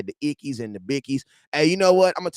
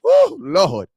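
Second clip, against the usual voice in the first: high, loud whoop; loud high whoop of praise; excited whoop; 13 semitones higher, 9 dB louder than usual. Real, not acted. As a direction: holler it like church. Exclamation after praise.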